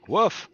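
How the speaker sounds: loud high barked woof; dog woof. Retold or imitated. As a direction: bark it like a dog. GM mimics dog bark woof.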